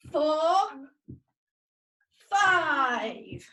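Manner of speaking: loud exercise count, last number drawn out. Real, not acted. Teacher counting children through star jumps, counting out. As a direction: call out the count loudly.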